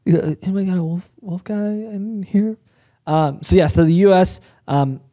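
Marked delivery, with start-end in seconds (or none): 0.0-2.6 youth pastor voice. Pretend. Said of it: "something a youth pastor would ask".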